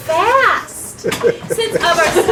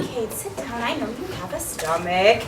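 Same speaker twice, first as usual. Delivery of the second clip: much lower and softer, almost murmured; sudden hush; 10 semitones lower, 10 dB quieter, faster than usual. Acted. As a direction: drop to a near murmur for Kate. Petruchio suddenly soft to Kate.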